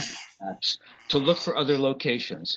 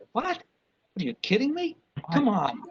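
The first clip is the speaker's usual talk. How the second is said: incredulous outrage; 8 semitones higher than usual. Real, not acted. Speaker outraged at tower company.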